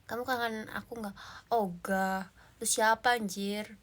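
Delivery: usual speaking voice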